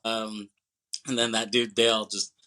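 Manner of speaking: ordinary delivery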